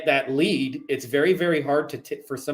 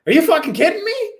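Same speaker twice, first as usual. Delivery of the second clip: much higher and louder, an incredulous outburst; excited disbelief; 9 dB louder than usual. Retold or imitated. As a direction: blurt it out like you can't believe your luck. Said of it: "And he said".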